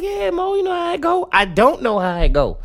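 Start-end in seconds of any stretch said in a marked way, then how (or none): 0.0-1.3 very high-pitched mimicked homegirl voice. Homegirl answering her friend's teasing, high mimicking voice. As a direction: pitch it way up, imitating her reply.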